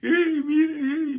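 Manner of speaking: very high, loud mock wail like a weeping ghost; mock Llorona wailing. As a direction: ham up a crying woman, high and shrill. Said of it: "parecer a la Llorona, que todo el tiempo estemos llorando".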